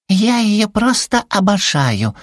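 very high, slow, cutesy swoon; adoring little creature. A narrator's reading. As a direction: swoon over it in a tiny high voice. Зверёк обожает газировку. (восторженный писклявый голосок, протяжно)

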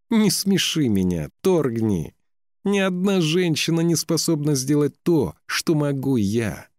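high-pitched, haughty mockery; derisive. A narrator's reading. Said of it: "рассмеялась очень неприятным скрипучим смехом". (насмешливо и заносчиво, высоким голосом)

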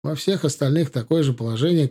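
plain reading voice

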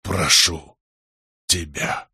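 faint, halting plea; weakening moan. A narrator's reading. Prince begs the god, failing voice. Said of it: "простонал князь слабеющим голосом".

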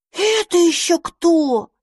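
high, slow, amazed question; dandelion astonished at the strange newborns, slow and high. Acted. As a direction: stretch it out in wide-eyed wonder. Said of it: "изумился одуванчик".

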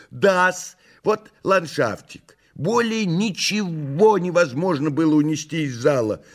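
high theatrical cat voice; Behemoth the cat, reporting. Acted. Behemoth resuming his report to messire, high theatrical voice.

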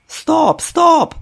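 high, loud shouted stop; yelled. Acted. Director halting the failed take, shouting. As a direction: cut the take with a yell. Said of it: "заволав режисер".

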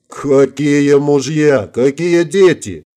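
slow, drawn-out menacing question; acted thuggish menace, very slow. A narrator's reading. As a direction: stretch each word out like a thug's challenge. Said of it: "грозно нахмурился парень".

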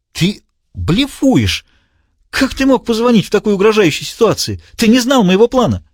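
unsettled, press him hard; disbelieving villain. A narrator's reading. Diogenes voiced high.

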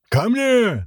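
high-pitched, commanding yell; full-force bellow. A narrator's reading. Governor summoning his men, bellowing. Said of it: "заорал во всю мощь мужской голос".

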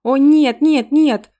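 loud alarmed wail, voice jumping up. Acted. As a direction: cry out in loud dismay. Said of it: "с воплями".

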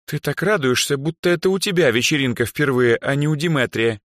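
high, wry jibe; wry scoff. A narrator's reading. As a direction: tease an overexcited friend. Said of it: "хмыкнула Эльза".

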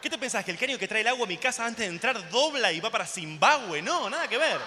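loud, heated, rising retort; exasperated, full-voiced sarcastic outburst; indignant rant. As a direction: blast back at a preachy ecologist. Acted angry retort to ecologist.

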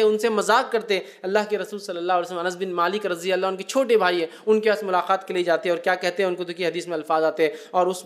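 ordinary talk, nothing marked

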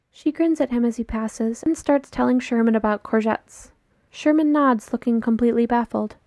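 usual speaking voice

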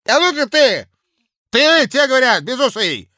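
put-on loud, high drunken bluster; drunken blustering official. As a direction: boast it loud like a tipsy clerk. Drunk Kozulin bosses.

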